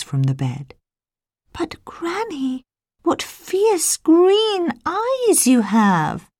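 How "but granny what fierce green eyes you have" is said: put-on high, slow child's voice; little girl voice. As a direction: ask slowly like a frightened small girl. Child questions disguised wolf.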